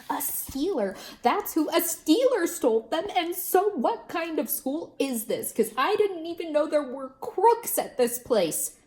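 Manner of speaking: put-on a little girl complaining to her teacher; upset kid voice. A narrator's reading. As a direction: tell it with worked-up indignation. Upset child answers teacher.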